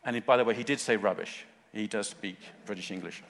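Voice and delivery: ordinary talk, nothing marked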